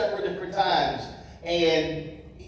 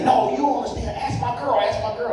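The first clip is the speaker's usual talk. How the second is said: high, eager insisting; insistent fan; 8 semitones higher, much faster than usual. Retold or imitated. Fan insisting his girlfriend can confirm, high and eager. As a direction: press the point, pitched up.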